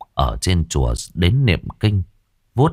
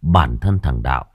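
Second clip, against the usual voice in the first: ordinary delivery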